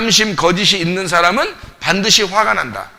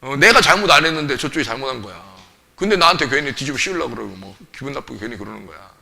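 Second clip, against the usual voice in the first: aggrieved grumble; 5 semitones lower than usual. Acted. Voices a wronged person's grumbling.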